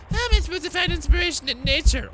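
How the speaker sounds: very high, loud whine; hammed-up desperate wail; frantic complaint. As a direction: play a composer at wits' end. Acting out composer's frustrated complaint.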